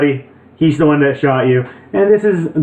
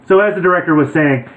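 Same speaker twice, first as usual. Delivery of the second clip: ordinary talk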